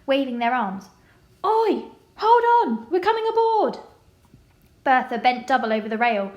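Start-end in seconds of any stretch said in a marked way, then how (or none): 1.4-3.8 loud, high yell from the dock; yelling men. A narrator's reading. Stokers on the dock yelling to the ship, loud and high. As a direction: shout to stop the ship leaving. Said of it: "They were yelling".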